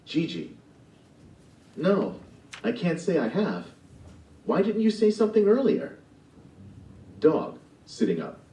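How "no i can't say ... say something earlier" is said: why didn't you say so; indignant surprise. Acted. Gigi questioning the cat.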